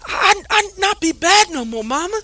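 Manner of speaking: high, loud, pleading promises; a boy begging through tears; sobbing child. Acted. Child promises dying mother.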